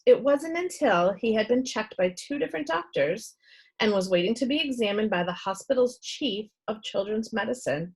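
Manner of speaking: plain reading voice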